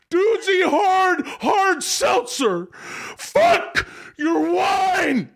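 high, slow furious yelling; angry rant. Acted. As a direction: rant at the wife, building to a crying shout. Acted ad, husband ranting at wife.

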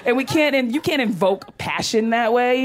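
ordinary talk, nothing marked

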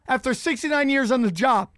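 usual speaking voice

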